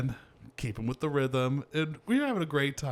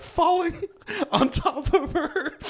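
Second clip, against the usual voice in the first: laughing; 3 dB louder, slower than usual. Real, not acted. Laughing retelling embarrassing fall.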